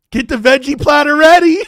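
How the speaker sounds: loud, high, rising call; gleeful holler. Real, not acted. Fan calling for the veggie platter, excited holler. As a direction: holler it out to the house.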